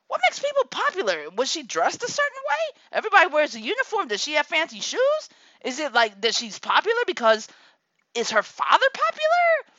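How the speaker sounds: rapid-fire high questions; hammed-up nosy mom grilling the kid; mock interrogation.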